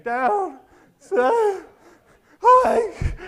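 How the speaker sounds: high, loud cracking cry of football calls; hammed-up tearful yell of the snap count; mock crying. Young player calling the snap while crying, high loud wail. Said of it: "crying as I'm saying".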